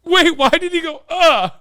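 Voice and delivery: high, loud disbelieving question; incredulous. Real, not acted. Streamer mocks the grunt in disbelief.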